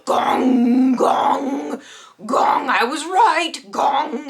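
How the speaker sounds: loud, high, wailing bell gongs; alarm bell gongs. Retold or imitated. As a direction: boom out a mock alarm bell, slow and crying. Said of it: "the agoraphobia bells were like".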